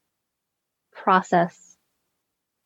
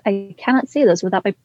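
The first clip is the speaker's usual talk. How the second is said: ordinary delivery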